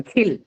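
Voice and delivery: "kill" with a puff of air on the k; aspirated k. Shown as a demonstration. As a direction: release the k of "kill" with a clear breath before the vowel. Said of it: "going to have an aspiration".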